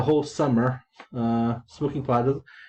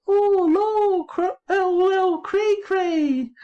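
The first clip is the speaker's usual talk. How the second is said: put-on very high squeaky child's voice; squeaky girl voice; much slower than usual. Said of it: "so she said".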